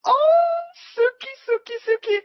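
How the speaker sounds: shrieking with joy, very high; celebrating. Real, not acted. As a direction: squeal it out in triumph. Target hit, celebrating.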